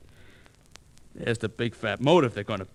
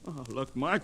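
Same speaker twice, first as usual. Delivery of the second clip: ordinary talk, nothing marked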